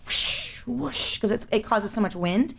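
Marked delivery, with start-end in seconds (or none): 0.0-1.2 soft imitated whoosh of rushing air; whooshing sound. Guest imitating the swinging chandelier, soft airy whoosh. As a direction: whoosh like something huge swinging past. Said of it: "you could hear something like".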